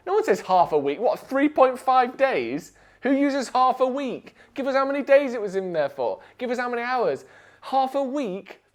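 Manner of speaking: high-pitched disbelief, slowing to stress each question; mock outrage at a silly phrase; incredulous rant. Real, not acted. Ranting about "half a week.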